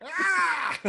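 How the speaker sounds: high mocking shriek. Imitating the privileged lady, high mock shriek. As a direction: shriek like an unhinged woman.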